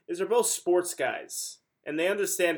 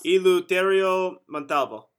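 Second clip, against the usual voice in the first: Eleuterio Montalvo in a light Italian accent, rolled and sung; 3 semitones higher, 5 dB louder than usual. Shown as a demonstration. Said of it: "just do it in an Italian accent".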